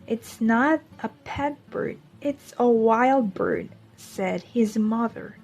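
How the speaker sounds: put-on gentle picture-book voice for young listeners; storybook reading. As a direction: read it like story time in class. Reads a picture book to children.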